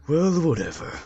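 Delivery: ordinary talk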